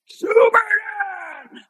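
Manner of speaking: very high, drawn-out holler; Cliff Stewart's booming call. Retold or imitated. Storyteller imitating an old master calling him that afternoon, booming holler. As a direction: imitate a booming hail, stretched right out. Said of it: "I hear this booming voice, Cliff Stewart".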